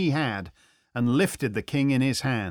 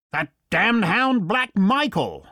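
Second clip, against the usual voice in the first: mild seething anger, raised pitch; through gritted teeth; 6 semitones higher, 4 dB louder than usual. A narrator's reading. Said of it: "said Fritz between his teeth".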